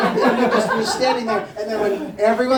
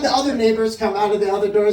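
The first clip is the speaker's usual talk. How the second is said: normal talking voice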